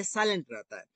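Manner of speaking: normal talking voice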